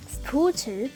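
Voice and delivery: plain reading voice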